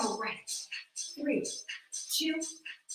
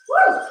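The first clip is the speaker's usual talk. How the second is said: excited whoop; 12 dB louder than usual. Real, not acted. Instructor cheering mid-set.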